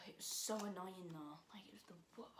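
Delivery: hushed frustrated whisper. Real, not acted. Vlogger whispering her annoyance to camera. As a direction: breathe it out under your breath.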